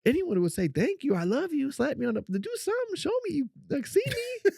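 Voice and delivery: very high, loud pleading rant; squeaky indignant whining; exasperated complaint. Retold or imitated. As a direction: demand appreciation, half laughing. Retelling his indignant complaint to therapist.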